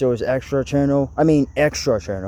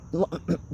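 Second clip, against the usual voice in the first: throat clearing; 11 dB quieter than usual. Real, not acted. Clears throat mid-sentence.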